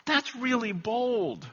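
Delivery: slow, hushed, amazed at himself; a quiet did-I-really-say-that; slow hushed wonder; stunned disbelief. Retold or imitated. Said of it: "where I go".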